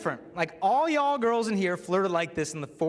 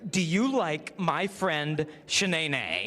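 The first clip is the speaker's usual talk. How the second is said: slow sugary note; much slower than usual. Retold or imitated. Reads out the note.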